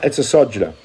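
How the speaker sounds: "sojourner" stressed on SO; "sojourner" said as SOJ-uh-nuh; first-syllable stress. Shown as a demonstration. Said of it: "put the emphasis on the first syllable".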